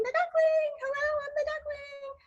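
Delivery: very high-pitched little duckling; high cartoon duckling voice; cute duckling voice. Shown as a demonstration. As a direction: squeak it out like a cute, tiny duckling. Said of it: "I do the duckling".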